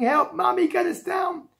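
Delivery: put-on high, small, distant-sounding child cries; screaming children. A narrator's reading. Children on the wheel calling Mummy, mock screaming. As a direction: squeal for help without much volume. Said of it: "the children started screaming".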